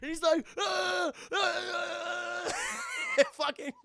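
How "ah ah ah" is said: high, strained mock screams; hammed-up screams climbing ever higher; mock agonized screaming. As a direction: wail as the rat digs in. Rat burrowing into cop.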